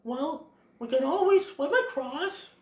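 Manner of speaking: high, put-on little critter voice; Flower. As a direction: pitch it up as a helpful little animal. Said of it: "And then Flower goes".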